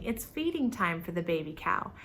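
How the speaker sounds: ordinary delivery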